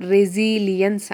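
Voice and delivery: "రెసిలియన్స్" pronounced re-ZEE-lee-ens; correct pronunciation. Shown as a demonstration. Teacher corrects a common mistake for students, model pronunciation of "రెసిలియన్స్" as re-ZEE-lee-ens. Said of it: "అని పలకాలి".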